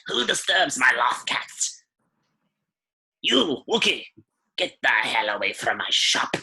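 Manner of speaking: put-on crotchety old shopkeeper snapping; grumpy old shopkeeper. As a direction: scold like a cranky old lady. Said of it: "and she goes".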